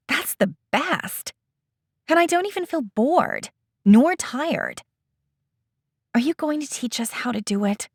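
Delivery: high, fast and eager; excited girl. Acted. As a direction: bubble over with delight. Eda thrilled by the idea.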